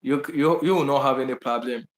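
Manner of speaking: ordinary delivery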